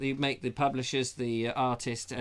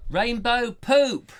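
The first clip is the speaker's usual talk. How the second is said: slow, loud, higher exclamation; gleeful shout; 8 semitones higher, 8 dB louder, much slower than usual. Real, not acted. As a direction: shout it with delight. Joyful shout of the punchline.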